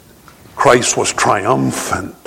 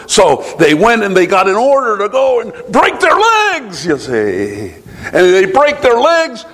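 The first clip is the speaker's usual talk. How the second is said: fervent preaching; 9 semitones higher than usual. Acted. Preaching at full force.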